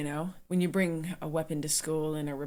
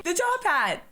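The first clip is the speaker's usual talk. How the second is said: excited; 11 semitones higher, 4 dB louder than usual. Real, not acted. Spots top hat in movie.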